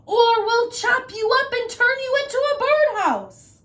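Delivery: high loud threat; threatening shout. Acted. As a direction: woodcutter scaring off wolf. Woodcutter threatening.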